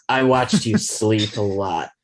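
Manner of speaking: slow, lightly creepy Edward; a hint of a creepy vampire boyfriend; slow mock creepy line. Friends mocking Edward's creepy confession, slow.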